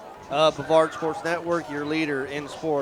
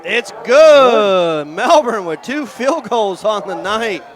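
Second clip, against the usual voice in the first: excited call; 4 semitones higher, 10 dB louder, slower than usual. Real, not acted. Play-by-play call of a made field goal.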